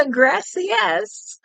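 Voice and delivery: put-on gracias up high in a Texan accent, laughing; a giggly Texan gracias; mock Texan Spanish. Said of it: "Texan, right?".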